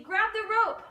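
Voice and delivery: loud, high shout; cried out. Acted. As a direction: yell it down from the helicopter. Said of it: "they cried".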